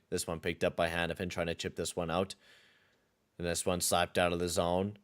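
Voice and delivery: steady, slightly low play-by-play call; low-key announcer calling the action; hockey play-by-play. Acted. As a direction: narrate the replay like a broadcaster. Calls the game video.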